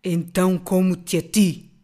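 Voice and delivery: slow, loud, looming monster voice; voz ameaçadora. A narrator's reading. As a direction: menace her slowly like a beast. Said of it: "uma voz ameaçadora". (voz de monstro ameaçadora)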